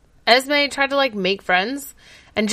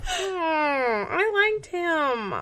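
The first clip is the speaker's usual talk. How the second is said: slow, quiet mock crying; mock sad; 7 semitones higher, 7 dB quieter, much slower than usual. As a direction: fake a tearful whimper. Mourning the dead character.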